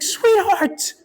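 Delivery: very high, hammed-up gentle reproach; husband sighing at his wife over the car, hammed-up gentle reproach; mock gentle scolding. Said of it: "I was like".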